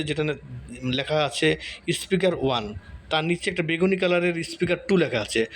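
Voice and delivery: ordinary talk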